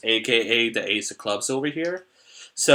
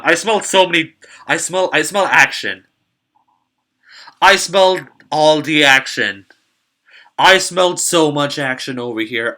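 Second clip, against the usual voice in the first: excited; 3 semitones higher, 7 dB louder than usual. Real, not acted. Excited about big hand.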